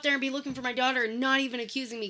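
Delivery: fast, heated rant; imitated angry dad. Father scolding the cop, fast mock anger. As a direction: fire it back at the cop, heated.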